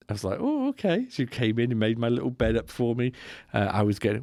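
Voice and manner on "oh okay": much higher, delighted "Oh, okay"; mock surprise. Passenger offered his bed made up, high surprised. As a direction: squeak it out pleasantly surprised.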